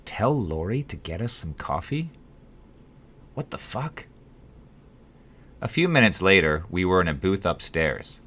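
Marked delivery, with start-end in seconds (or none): 0.1-2.1 softer, rising in disbelief; can't believe what he just heard; incredulous repeat. A narrator's reading. The host repeats the boss's order, softly incredulous. 3.3-4.1 softer, higher, baffled; half to himself, utterly thrown; stunned disbelief. A narrator's reading. The host reacts to the boss's request, hushed and baffled.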